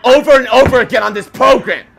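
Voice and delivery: loud, high scolding rant; exasperated shouting. Acted. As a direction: berate the audience. Host scolds audience.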